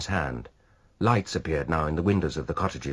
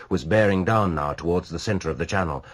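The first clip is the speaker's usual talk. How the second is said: plain reading voice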